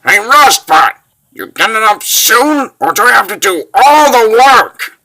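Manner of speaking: loud, high, rousing call; teasing shout. Acted. Traveller goading a fallen friend to get up, shouting. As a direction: holler to get a lagging partner moving. Calls to fallen companion to hurry.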